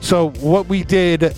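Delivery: ordinary delivery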